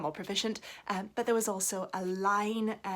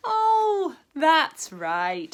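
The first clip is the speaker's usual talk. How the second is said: frog remembering; 8 semitones higher, 10 dB louder, much slower than usual. Acted. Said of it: "said the frog".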